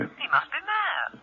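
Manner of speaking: dry disbelief. Acted. Reacting to Steed's dummy friend.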